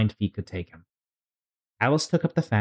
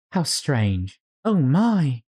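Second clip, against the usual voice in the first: put-on lightly raised girlish Alice voice; Alice's voice; 6 semitones higher, 4 dB louder, much slower than usual. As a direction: read Alice a bit higher, amazed. Alice voiced in read story.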